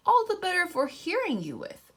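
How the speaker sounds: the wolf playing Grandma badly; wobbly granny voice. Wolf pretending to be Grandma to the girl, put-on wobbly voice. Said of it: "same wobbly voice".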